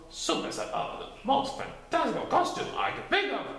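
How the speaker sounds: high airy critter voice; a bouncy little voice, breathed out; Titter. A narrator's reading. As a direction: Titter agreeing eagerly.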